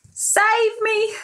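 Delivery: high begging plea. Acted. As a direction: plead to be rescued. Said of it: "begged Melon".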